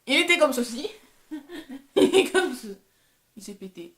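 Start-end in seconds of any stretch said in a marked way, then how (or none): 0.0-2.7 high, loud burst cracking into laughter; laughing outburst. Real, not acted. Vlogger showing viewers her broken makeup, laughing outburst. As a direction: break up laughing mid-sentence, much louder.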